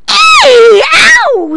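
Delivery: high, loud pained bat shriek. A narrator's reading. Bat shrieks in pain as the witch pinches it. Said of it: "said a bat".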